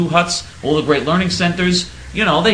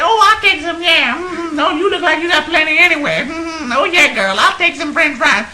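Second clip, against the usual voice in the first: much higher, put-on woman's voice with a laugh; mock Brasilia; 12 semitones higher, 4 dB louder than usual. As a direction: eagerly accept the fries, ham it up. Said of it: "She said".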